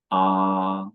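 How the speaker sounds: "ah" held long on a high, level tone; "ah" as a steady Mandarin first tone. Shown as a demonstration. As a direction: sustain "ah" evenly, no rise or fall. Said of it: "first tone is high and level".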